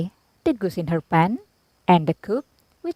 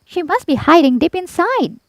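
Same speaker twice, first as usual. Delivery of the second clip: high, sly goose voice; 9 semitones higher, 4 dB louder than usual. A narrator's reading. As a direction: fib sweetly about the snurd. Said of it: "goose continues".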